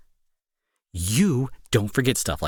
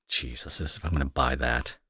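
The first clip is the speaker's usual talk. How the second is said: muttered aside; 12 semitones lower, 6 dB quieter than usual. Acted. To the partner who stood her up, a muttered scoff. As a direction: drop to a scornful under-breath mutter.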